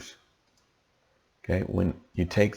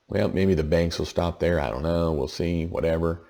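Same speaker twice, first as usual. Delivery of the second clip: usual speaking voice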